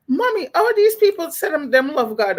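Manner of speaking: put-on high, little-kid voice asking mommy; a confused child tugging at her mother. Vlogger as a puzzled child asking her mother, high and childlike.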